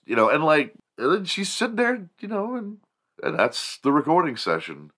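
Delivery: ordinary talk, nothing marked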